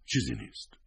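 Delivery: softly murmured brush-off. A narrator's reading. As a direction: murmur it, dropping the volume. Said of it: "زمزمه کرد".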